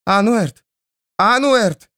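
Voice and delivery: high frantic call of a name; desperate calling. Acted. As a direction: shout his name to wake him. Trying to revive him.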